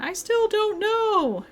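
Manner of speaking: high, loud, drawn-out cry; stretched-out, high-pitched mock wail; exasperated wail. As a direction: wail it slowly in mock despair. Acted forum post, confused outburst.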